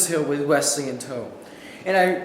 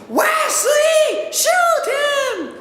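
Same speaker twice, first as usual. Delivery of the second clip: high, loud screech; 3 dB louder, much slower than usual. Retold or imitated. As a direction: screech at your buddy to fire. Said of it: "I just start screeching".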